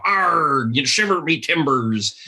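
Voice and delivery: exaggerated West Country pirate accent; Robert Newton pirate accent. Shown as a demonstration. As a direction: growl out a stock Treasure Island pirate accent. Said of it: "sort of accent".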